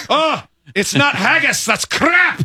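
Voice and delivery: very high, indignant mock-Scottish voice; mock Scottish checkout. Joking as the Scottish self-checkout, mock outrage.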